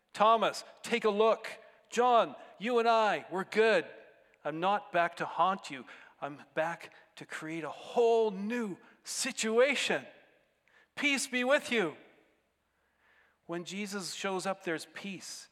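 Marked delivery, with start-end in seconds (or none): 0.1-12.0 loud, higher, declarative assurance; emphatic reassurance. Acted. Jesus reassuring the disciples by name, emphatic. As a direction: proclaim forgiveness to each friend by name.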